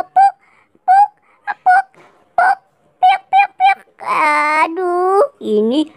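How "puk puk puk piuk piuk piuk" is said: high, loud squeaky piuk noises; high mock pop sounds; high squeaky sound effects. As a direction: make little cartoon squeak noises.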